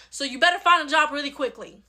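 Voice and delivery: normal talking voice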